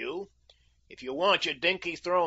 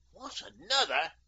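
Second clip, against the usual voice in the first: higher-pitched testy exclamation; 5 semitones higher than usual. A narrator's reading. King hearing of one more condition, testy. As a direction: exclaim it with irritated disbelief. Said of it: "exclaimed Leopold testily".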